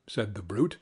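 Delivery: usual speaking voice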